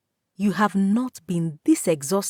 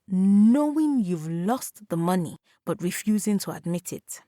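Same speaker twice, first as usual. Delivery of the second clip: ordinary delivery, nothing marked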